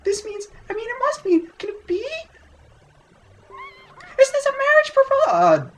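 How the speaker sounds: hammed-up falsetto of a swooning village girl; high-pitched girly voice. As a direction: squeal it out like a lovestruck girl. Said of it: "my high-pitched girly voice".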